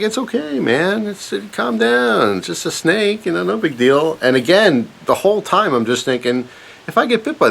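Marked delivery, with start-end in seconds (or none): 0.0-4.2 much higher, slow mock-soothing dad; calm dad. Dad calming his panicking son in the boat, high slow imitation. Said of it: "the whole time my dad's like".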